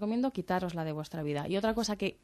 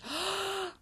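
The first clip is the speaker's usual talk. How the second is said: sharp intake of breath; sharp gasp; 12 semitones higher, 7 dB quieter than usual. Shown as a demonstration. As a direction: gasp as if mint burned. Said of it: "ha hecho así".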